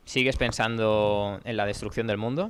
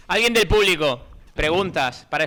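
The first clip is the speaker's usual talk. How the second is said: loud, high call to the audience; raised, projected host voice; 6 semitones higher, 8 dB louder than usual. Real, not acted. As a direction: throw the question out to a crowd.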